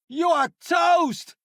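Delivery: high shouted taunt at the enemy; battle taunt shout. Acted. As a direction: yell it like you've already won.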